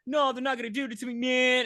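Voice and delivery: panicked protest. Retold or imitated. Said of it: "He's like".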